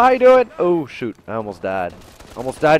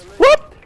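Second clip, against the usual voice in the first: very high, loud whoop; sudden whoop; 11 dB louder than usual. Real, not acted. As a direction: jump with a short high whoop. Startled whoop in game.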